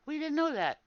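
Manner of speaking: high fake amazement; mocking the raiders' fake surprise; mock surprised guild. Streamer mimics raiders' fake amazement, high and mocking.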